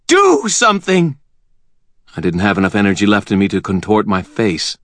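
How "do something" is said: high frantic plea; desperate howl. A narrator's reading. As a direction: beg him to act. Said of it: "Butters howled".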